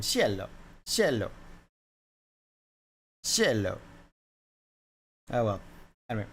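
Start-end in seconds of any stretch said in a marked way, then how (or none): 0.0-3.8 "Cielo" with an initial CH sound, "cheh-lo"; Italian pronunciation. Shown as a demonstration. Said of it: "That's in Italian".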